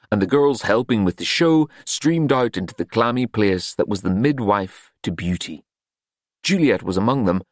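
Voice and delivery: ordinary delivery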